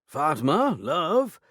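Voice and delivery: called through a door, high and loud; calling out. A narrator's reading. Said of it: "Lord Dingley called".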